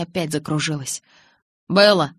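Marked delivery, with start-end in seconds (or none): 1.7-2.1 loud, short call; raised-voice call; calling her name. A narrator's reading. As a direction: call out to get her attention. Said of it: "позвал Джейкоб".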